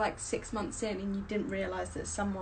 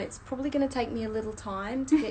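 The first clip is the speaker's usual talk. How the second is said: put-on Australian accent; 3 semitones higher, 10 dB louder than usual. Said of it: "Give us your best shot at an Aussie accent".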